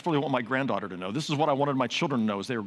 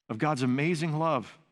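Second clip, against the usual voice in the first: usual speaking voice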